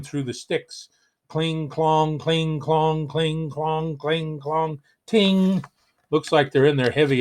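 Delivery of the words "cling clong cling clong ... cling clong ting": sing-song cling clong of marching armor; clanking armor noises. Acted. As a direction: chant the clanks like knights marching past.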